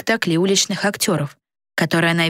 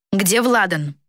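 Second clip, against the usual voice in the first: insolent; 2 semitones higher, slower than usual. A narrator's reading. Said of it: "нагло спросила Дана".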